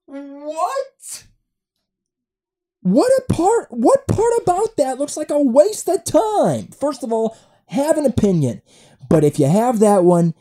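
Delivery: high, loud comic rant; outraged disbelief. Acted. Podcaster ranting at his friend's post, outraged. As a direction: burst out indignantly, pitch way up.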